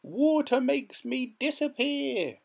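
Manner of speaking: very high, slow, wailing witch; dissolving witch wail; shrieking witch. A narrator's reading. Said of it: "she shrieked".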